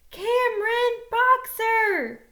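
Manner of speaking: loud, high, drawn-out shout of the name; yelling a name. Acted. Girl pounding on the door shouts his name. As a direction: call him out from outside the house.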